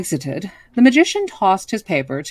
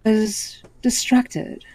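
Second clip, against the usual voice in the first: ordinary delivery, nothing marked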